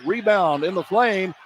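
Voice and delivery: ordinary talk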